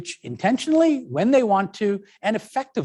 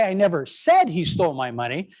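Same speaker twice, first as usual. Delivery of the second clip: said punched, rest plain; stress on SAID. Shown as a demonstration. Teacher showing implied meaning, stress on said. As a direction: lean on said, rising at the end. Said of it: "then I'm saying".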